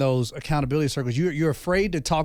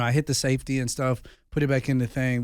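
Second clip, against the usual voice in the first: ordinary talk, nothing marked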